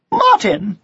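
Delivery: sharp, high-pitched call. A narrator's reading. Mother catches her son in the garden, sharply. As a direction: call him up short. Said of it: "she said sharply".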